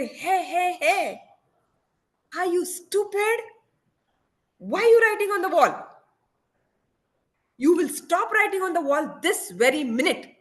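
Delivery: high-pitched acted scolding of a child; angry father. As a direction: act out a dad losing his temper. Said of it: "And I lost it".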